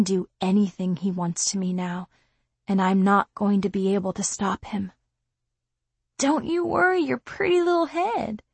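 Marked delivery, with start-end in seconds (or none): 6.2-8.4 skinhead. Acted. Skinhead threatening the girl.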